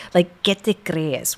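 Mexican Spanish retort. Retold or imitated.

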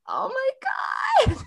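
mock-exasperated high-pitched cry. Performer reacting to endless pressure to please, exasperated. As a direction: exclaim it high, as if overwhelmed.

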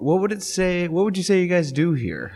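mock boss voice. Office Space boss quote.

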